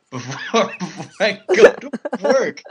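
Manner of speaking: high, loud, cracking up mid-impression; laughing through words. Real, not acted. Storyteller breaking up while quoting the morning-after date, laughing. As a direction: lose the imitation to real laughter.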